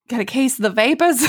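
put-on mild Southern accent; light Southern accent. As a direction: tease it with a slight Southern lilt. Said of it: "the Southern is getting worse".

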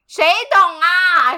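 ordinary delivery

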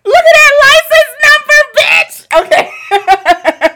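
high, loud, drawn-out triumphant yell; super hyped. Real, not acted. Therapist sees their own directory listing, super-hyped yell. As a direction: shout it out in real jubilation. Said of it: "I'm super hyped".

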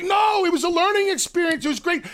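cheerful insistence; high, enthusiastic reassurance. Retold or imitated. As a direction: reassure her, high and bright. His own retold reassurance.

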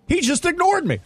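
whiny complaining voice. Retold or imitated. Whiny coworker imitated.

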